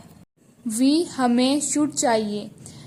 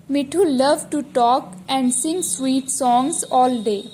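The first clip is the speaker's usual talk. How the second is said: slow dictation reading; much slower than usual. Shown as a demonstration. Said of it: "teacher reads the sentence slowly".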